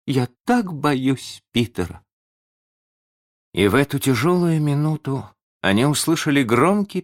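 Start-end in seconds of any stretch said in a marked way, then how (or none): 0.1-2.1 slow, high, fearful voice; mildly frightened, drawn-out admission; shuddering. A narrator's reading. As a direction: confess it with a touch of dread. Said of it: "содрогаясь".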